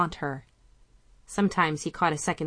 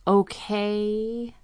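higher, long-suffering drawn-out okay; 4 semitones higher than usual. Acted. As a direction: stretch it into a weary sigh. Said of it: "drew the word out to three long, suffering syllables".

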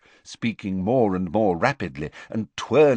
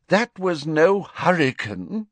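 slow, higher, miserable; 10 semitones higher, 4 dB louder, slower than usual. Acted. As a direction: say it wretchedly. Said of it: "said Fudge miserably".

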